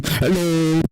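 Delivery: test yell. Shown as a demonstration. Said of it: "Can I yell in the microphone?".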